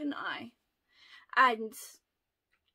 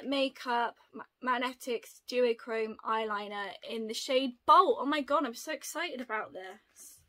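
ordinary delivery

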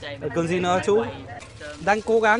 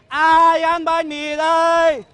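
loud, slow drawn-out vendor call; vietnamese street cry; 7 semitones higher, 4 dB louder, slower than usual. Acted. Calling out bread for sale.

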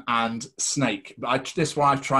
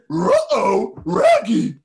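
much higher, louder cartoon dog voice; Scooby-Doo; 10 dB louder, much slower than usual. Recalling his co-host's Scooby-Doo, a high, loud cartoon dog. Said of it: "you've done that on a Scooby-Doo".

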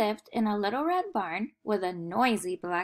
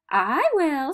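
bright, louder smiling cat; 6 semitones higher, 8 dB louder than usual. A narrator's reading. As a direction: say it with a smile. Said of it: "smiled the cat".

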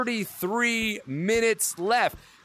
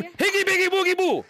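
high playful shout; 9 semitones higher than usual. Shown as a demonstration. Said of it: "we're gonna scream".

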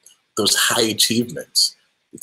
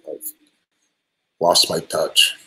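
ordinary talk, nothing marked

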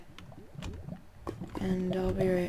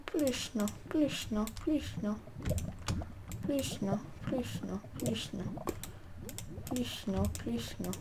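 pleading; 9 dB quieter than usual. Real, not acted. Pleading as loss unfolds.

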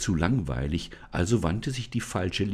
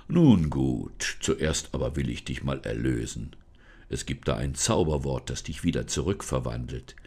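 forest spirit, solemn; 6 semitones lower than usual. Acted. Forest spirit introduces himself to Lisa, low and solemn. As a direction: speak lower as the unseen forest guardian. Spirit explains magic word.

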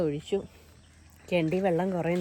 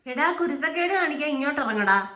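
yelling; 6 semitones higher, 5 dB louder than usual. Real, not acted. Scolding naughty pet to come down.

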